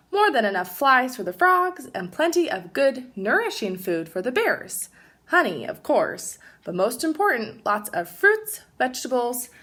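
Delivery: lively storybook read-aloud; animated picture-book narration; reading to children. Acted. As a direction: read it like story time for kids.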